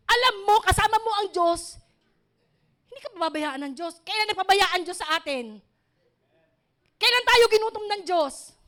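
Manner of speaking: rising pulpit fervour, pressing the people to believe; fiery preaching. Real, not acted. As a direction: preach it hard to the whole church. Preaching at full force.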